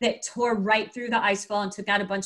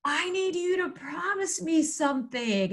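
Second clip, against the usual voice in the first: high, slow mock shout at normal volume; retold yell; 6 semitones higher than usual. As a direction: imitate calling out over wind, words stretched. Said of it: "we're yelling".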